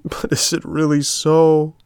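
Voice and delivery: high, slow and tearful; sobbing. A narrator's reading. Puppet to the marmot, slow and tearful. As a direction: plead through the sobs.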